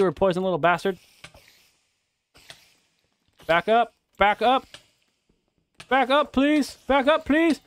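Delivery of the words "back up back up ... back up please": high loud desperate back up please; panicked pleading. Real, not acted. Cornered by the spider, high panicked pleading.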